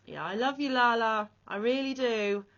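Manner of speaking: telling her friend she loves her, close to tears; emotional, near tears. Real, not acted. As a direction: call out your love while fighting back tears. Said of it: "I nearly started crying".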